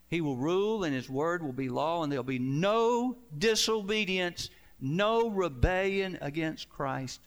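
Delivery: loud, rising sermon proclamation; preaching at full force. Acted. Preacher to congregation on Christ's reign, loud preaching. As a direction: thunder it out like a pulpit climax.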